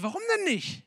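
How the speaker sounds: high-pitched, bewildered question; totally irritated. Acted. Bar owner questioning the man who said no, totally irritated. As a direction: act out someone completely thrown by a refusal. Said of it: "er war total irritiert".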